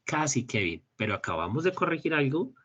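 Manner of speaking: usual speaking voice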